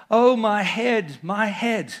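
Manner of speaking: high, loud, slow cry of pain; child's pained cry. Acted. Boy cries to his father about his head. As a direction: wail over an aching head.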